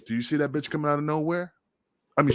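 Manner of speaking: ordinary talk, nothing marked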